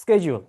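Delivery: শিডিউল said as "SKE-jul", falling; corrected pronunciation. Shown as a demonstration. Teacher modelling it for the learner, শিডিউল as "SKE-jul". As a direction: sk start and j in শিডিউল. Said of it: "আপনি আজকে থেকে শিডিউল বলবেন".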